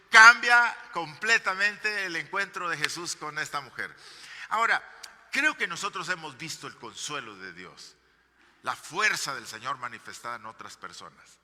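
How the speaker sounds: loud, rising sermon delivery; fervent pulpit preaching; preaching at full force. Acted. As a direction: preach it out to the congregation, building.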